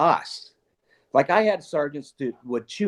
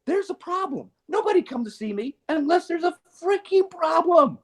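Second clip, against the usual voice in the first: exasperated chief. Retold or imitated.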